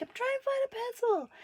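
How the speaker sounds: very high, anxious squeak; flustered child. Retold or imitated. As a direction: protest like a flustered little girl. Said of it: "I'm like".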